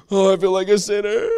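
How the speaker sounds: gasp, then a loud high wail; hammed-up panic. Acts out past panic at God's silence.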